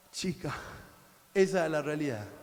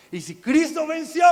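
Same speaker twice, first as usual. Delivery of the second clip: shouting; 10 semitones higher, 12 dB louder than usual. Retold or imitated. Climax of a retold prayer.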